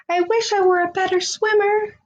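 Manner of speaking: put-on young narwhal wishing sadly; wistful narwhal wish. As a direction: sigh it out as the little narwhal. Kelp wishes aloud.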